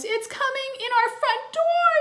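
put-on very high, loud child's shriek; squealing little-boy alarm; panicked yell. A narrator's reading. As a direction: yell it in terror. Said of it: "yelled Timmy".

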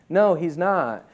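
ordinary talk

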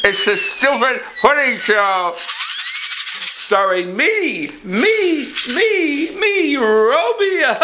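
rising high sing-song announcement; excited show intro. Acted. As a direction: announce yourself with giddy glee.

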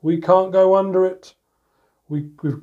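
usual speaking voice

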